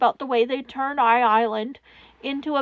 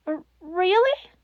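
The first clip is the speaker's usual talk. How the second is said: put-on timid child; 5 semitones higher than usual. A narrator's reading. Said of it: "child's hopeful disbelief".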